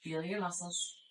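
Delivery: ordinary delivery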